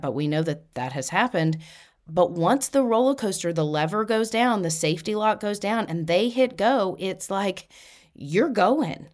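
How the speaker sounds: normal talking voice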